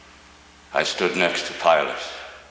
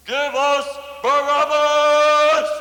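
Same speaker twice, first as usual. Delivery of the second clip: much higher, louder, slow roaring cry; thunderous crowd yell; 6 dB louder, slower than usual. Acted. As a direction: bellow it as the whole mob at once. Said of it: "yelled out in one loud, electrifying, thunderous voice".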